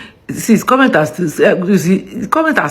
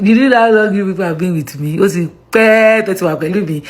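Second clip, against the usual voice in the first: ordinary talk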